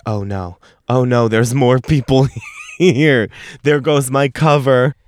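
playful mock panic, half-laughing; giggling pretend alarm, slowed on the oh no; laughing mock dismay.

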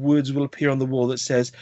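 usual speaking voice

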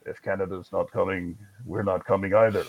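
a cartoon Soviet official in a clumsy Russian accent; bad Ivan Drago Russian accent. Host voicing the Soviets, joking bad Russian accent. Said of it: "worst Russian accent ever".

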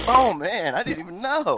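high-pitched, acted-out dismay; mock surprise. Cohost acts out a sudden accident while minding business, mock surprise. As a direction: play someone caught completely unawares, pitched high.

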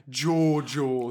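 low attempt at Scottish; Scottish accent. Shown as a demonstration. Podcaster showing co-host how it should go, Scottish accent. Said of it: "wouldn't Scottish be like".

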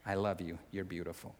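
very soft, low, careful reassurance; hushed placating. Acted. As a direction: back away gently, voice dropped very low. Soothing someone furious while backing away.

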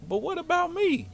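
very high mock whine; hammed-up, squeaky wounded question; hurt plea. Dumped boy pleading with his girl, comic high whine.

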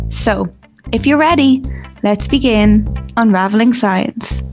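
upbeat announcer read over music; podcast intro announcer. Acted. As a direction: present it like a show opener.

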